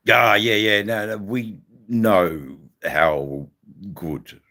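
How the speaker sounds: ordinary talk, nothing marked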